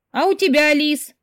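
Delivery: high, loud call. A narrator's reading. Sister calls to her in the fitting room, calling out. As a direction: call it to someone in another room. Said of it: "крикнула мне сестра".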